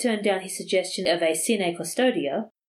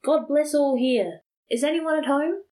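calling out; 8 semitones higher, 3 dB louder than usual. A narrator's reading. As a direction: call out a greeting to the whole house. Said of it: "he called out".

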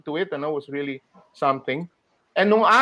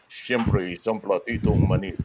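much lower, deep-voiced ad line; Bob Cepeda line; 10 semitones lower than usual. Shown as a demonstration. Voice actor demoing a colleague's deep commercial read. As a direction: drop deep into the voice for the tagline. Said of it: "Bob Cepeda would do".

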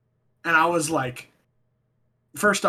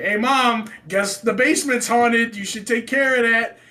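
loud, raised retold call upstairs; high, stretched-out urgent holler; calling to mom; 4 semitones higher, 5 dB louder than usual. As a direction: re-enact alerting mom loudly.

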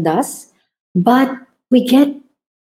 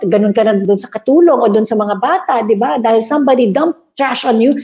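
ordinary talk

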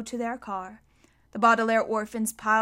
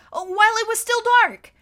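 put-on higher, louder young boy's voice; urgent boy; 11 semitones higher, 9 dB louder than usual. A narrator's reading. Klaus telling Mister Poe where the villains went. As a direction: sound like an eager young boy.

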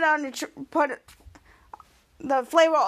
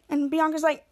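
ordinary delivery

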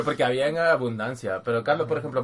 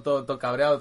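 usual speaking voice

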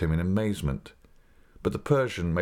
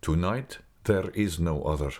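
usual speaking voice

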